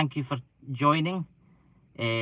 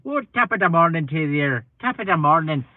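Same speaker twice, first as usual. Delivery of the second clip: louder, hammed-up Irish greeting; mock Irish cheer, pitched up; Irish accent; 3 semitones higher, 6 dB louder than usual. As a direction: put on a stage Irish accent, playfully loud. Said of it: "putting my Irish accent on it".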